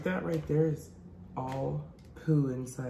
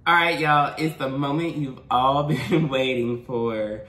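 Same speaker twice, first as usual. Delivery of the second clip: announcer; 2 semitones lower, 5 dB louder than usual. Acted. Announcing the big mukbang.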